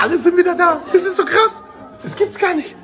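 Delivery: high squealing delight; excited squeal. Real, not acted. Greeting returning friends.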